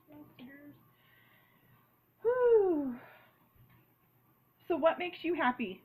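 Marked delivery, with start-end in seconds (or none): 0.1-0.7 trailing off to a murmur. Real, not acted. Musing to herself over stickers. 2.2-3.0 loud relieved exhale. Real, not acted. Relief after sticker work.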